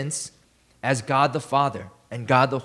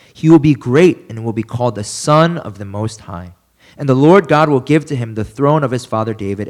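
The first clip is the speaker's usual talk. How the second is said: scripture reading; 3 semitones lower, 5 dB louder than usual. Acted. Pastor reading Luke to his congregation, solemn and louder. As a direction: read it out louder, with a preacher's weight.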